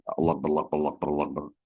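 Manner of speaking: Allah rattled off too fast and slurred together; hasty mumbled chant of Allah; rushed zikir. Shown as a demonstration. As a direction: gabble Allah with no thought, like a bad habit. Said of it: "terlalu cepat".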